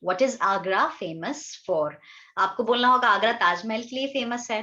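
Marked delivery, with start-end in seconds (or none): 0.1-1.9 slow model question. Shown as a demonstration. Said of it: "तो कैसे बोलेंगे?".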